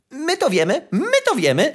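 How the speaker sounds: high, chirpy sparrow chatter in quick bursts; chirping sparrows. A narrator's reading. Sparrows eagerly chirping their news to the young fir tree, high and chirpy. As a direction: twitter it out like eager little birds. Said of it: "zaświergotały wróble".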